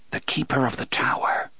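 hushed, frightened whisper; fearful whisper. A narrator's reading. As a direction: barely breathe the words in dread.

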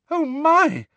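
high, loud frightened squeal; terrified squeal. Acted. Hermione at the giant rising, squealing in fright. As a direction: squeal it out in sudden terror. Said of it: "Hermione squeal, terrified".

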